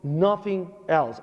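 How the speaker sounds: ordinary talk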